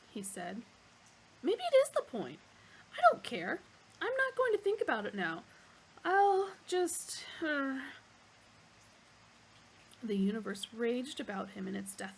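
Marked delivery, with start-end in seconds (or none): 1.4-7.8 flustered outburst. Acted.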